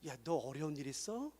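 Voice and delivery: soft, low caring question; gentle check-in. Shown as a demonstration. Pastor showing how to check on a member, soft and caring. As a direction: ask quietly like a friend. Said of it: "성도님들을 돌아봐 주세요".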